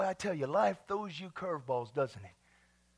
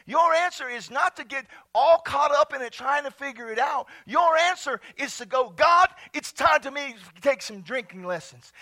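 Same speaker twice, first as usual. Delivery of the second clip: high, loud preacher's proclamation; preaching at full force; 7 semitones higher, 12 dB louder than usual. Real, not acted. Pastor proclaims Peter's speech to church, fervent preaching. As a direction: declaim scripture loudly with rising fervor.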